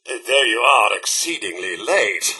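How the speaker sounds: ordinary talk, nothing marked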